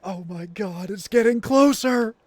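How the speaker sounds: rising to a loud high scream; mock horror. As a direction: panic as it gets closer. Mock horror at approaching noise.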